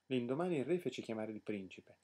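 ordinary delivery

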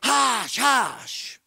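drawn-out, airy hush; shushing bystanders. Acted. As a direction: hush someone making a scene. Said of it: "the folks nearby began to say".

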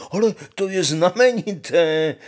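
hlasité nadšené zvolání, vyšší hlas; vykřikni to s nadšením jako Honza. Acted. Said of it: "zvolal Honza". (Honza nahlas a vysoko zvolá)

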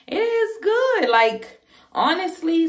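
high, slow and delighted; excited. Real, not acted. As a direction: gush about it. Delighted about pregnancy.

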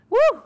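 a sudden high, loud whoop of delight; excited whoop. Real, not acted. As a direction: cheer out loud like the base is a triumph. Celebrating the eggnog base.

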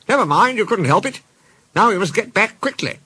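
high-pitched cricketer voice; high, urgent storybook reply; cricketers, hurried. A narrator's reading. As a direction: brush off the apology in a high voice.

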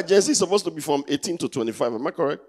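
normal talking voice

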